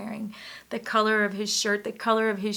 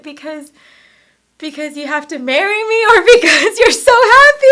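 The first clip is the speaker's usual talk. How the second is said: high, loud, through laughter; laughing question; 8 semitones higher, 17 dB louder than usual. Retold or imitated. Husband asks why she cries, laughing and loud. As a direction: laugh while asking.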